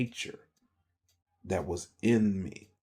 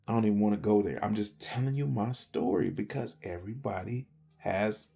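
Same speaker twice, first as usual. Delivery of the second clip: ordinary talk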